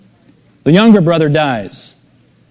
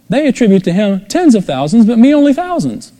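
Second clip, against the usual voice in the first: jealous complaint; 7 semitones higher than usual. Retold or imitated. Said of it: "he says".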